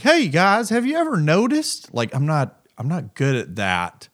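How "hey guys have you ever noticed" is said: mock stand-up comic opener, pitched up; hammed-up "have you ever noticed" comic; cheesy standup voice. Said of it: "I'm not good at just, like".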